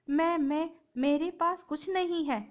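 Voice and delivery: cowering, lying in fright; stammering fear. Acted.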